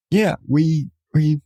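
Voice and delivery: ordinary talk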